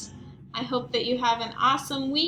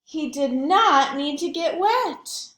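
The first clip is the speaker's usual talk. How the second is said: triumphant; 4 semitones higher, 7 dB louder, slower than usual. A narrator's reading. Triumphant narration, slow loud.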